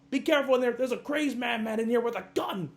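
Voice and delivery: hammed-up hysterical alarm; mock panicked warning. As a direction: yell a frantic warning about a madman. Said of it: "come on, come on".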